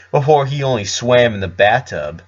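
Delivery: plain reading voice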